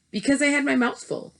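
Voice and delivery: put-on high, bright little boy. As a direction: Bruno speaking up high. Bruno voiced in story.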